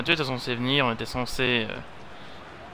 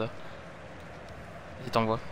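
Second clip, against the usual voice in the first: a whispered 'Vas-y' urging the player on; 4 semitones lower, 12 dB quieter, much slower than usual. Real, not acted. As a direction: breathe it to yourself, no voice. Urging player under breath. (chuchoté pour soi)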